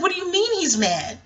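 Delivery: high-pitched retold disbelief; incredulous question. Woman challenging her family's explanation, incredulous. Acted baffled reply to family.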